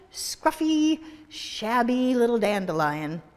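ordinary delivery, nothing marked

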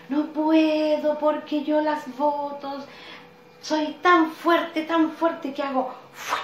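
the wind bragging to the tree, slow and loud; mighty wind. Acted. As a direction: stretch out each word like a gusty, proud wind. Wind character boasts of its strength.

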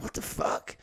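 voiceless, disbelieving aside; stunned whisper. Retold or imitated. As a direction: whisper it under your breath in shock.

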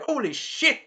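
high-pitched mock shock; startled exclamation. Alucard sees the spear coming, high mock shock. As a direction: jump up in pitch, retelling alarm.

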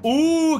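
loud, high drawn-out "ooh"; high whooping ooh; excited ooh. Real, not acted. Excited before game time.

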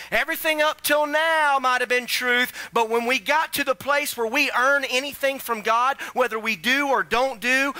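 high, loud preaching build; full-force preaching. Acted. Pastor preaching hard to his church, loud and high. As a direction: drive it home to the congregation.

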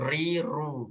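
"ri, ru" with the r trilled too long; over-roll the r in "ri, ru"; wrong takrir. Shown as a demonstration. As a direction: tajwid teacher shows the wrong trill. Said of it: "Bukan ro".

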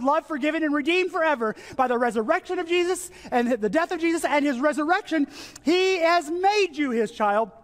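high-pitched, fervent sermon delivery; impassioned preaching. Real, not acted. Preacher assures the congregation they are God's children, impassioned preaching. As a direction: build into urgent proclamation, pitch climbing high.

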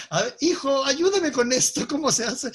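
imitating a parent stuck with a phone; parent asking for help. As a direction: ask your kid for help with the gadget. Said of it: "algunos les da".

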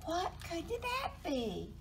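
high, alarmed mouse voice; high-pitched storybook fright; startled mouse. A narrator's reading. Frightened mouse sees a grin.

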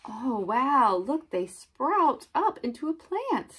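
high-pitched delight at a discovery; wide-eyed, lifted storytime awe; amazed wonder. Acted. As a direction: marvel aloud for young children. Marvelling at the sprouting seed.

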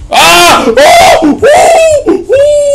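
high, loud startled yells; string of shrill panicked cries; startled screams. Real, not acted. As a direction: yelp in alarm, voice shooting way up. Startled by bombs in game.

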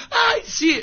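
high, mocking falsetto of a gullible woman. Preacher mocking a woman answering the witch doctor, high falsetto. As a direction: squeak it out like a naive client.

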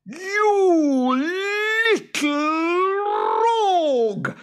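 very high, loud, drawn-out roar; enraged monster screech; roaring boggart. A narrator's reading. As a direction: roar it in outrage, stretching each word. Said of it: "roared the boggart".

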